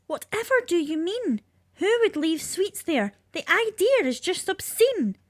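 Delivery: shocked Tooth Fairy. Acted. Tooth Fairy outraged at the dentist's story, high and shocked. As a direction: clutch your pearls, high and appalled.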